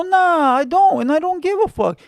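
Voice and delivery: defiant retort. Retold or imitated. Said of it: "I go".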